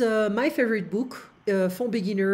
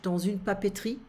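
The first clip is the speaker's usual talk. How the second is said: dans une papeterie run together quickly as one word; said fast; faster than usual. Shown as a demonstration. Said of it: "we need to say it fast in French".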